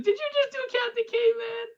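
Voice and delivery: high-pitched excited yelled question; retold yell. Host imitating the girl who caught the reference, high yell. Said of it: "she just yelled out".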